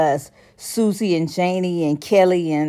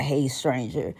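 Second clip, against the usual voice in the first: much softer, lower "Hey, stranger"; soft sly greeting; 5 semitones lower, 9 dB quieter than usual. Retold or imitated. Mocking the texter, low quiet "Hey, stranger". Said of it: "don't hit me with a".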